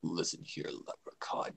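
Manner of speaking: soft unvoiced threat; whispered. Shown as a demonstration. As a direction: whisper it close to the mic. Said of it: "You know, I went like that".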